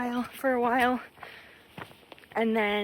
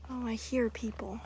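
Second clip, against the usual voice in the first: ordinary talk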